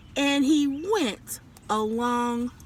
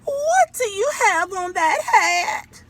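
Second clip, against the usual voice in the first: high, slow, loud scolding mother; scolding mama; 6 semitones higher, 7 dB louder than usual. A narrator's reading. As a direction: call him out like a shocked mama. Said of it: "looked at him and said".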